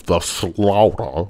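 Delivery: slaughter said very slowly; slow drawn-out. Shown as a demonstration. Host teaching chat to say the slaughter, very slowly. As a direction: stretch out slaughter. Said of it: "you gotta say it like that".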